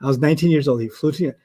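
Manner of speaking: normal talking voice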